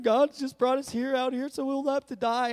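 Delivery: high-pitched complaining crowd, hammed-up; mock whining. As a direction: whine it like a grumbling mob. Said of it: "they're all whining".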